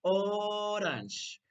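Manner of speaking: slow, drawn-out teacher's "orange"; model for repeating. Shown as a demonstration. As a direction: stretch "orange" for children to echo. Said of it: "repeat after me".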